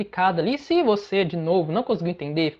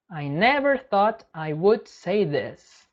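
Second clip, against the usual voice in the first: slow model sentence; much slower than usual. Shown as a demonstration. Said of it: "Então, eu nunca pensei que diria isso".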